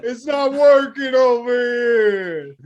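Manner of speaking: mock wiseguy. Cool Mike when the dog fails, high drawn-out complaint. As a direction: complain slowly, stretching the end. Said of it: "He's just like".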